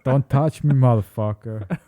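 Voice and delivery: put-on loud, slow, foreign-accented mangled Scottish; Tommy Wiseau. Imitating friend's failed Scottish impression, loud foreign accent. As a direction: drag it out, loud, in a botched accent. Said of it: "You sound like Tommy Wiseau".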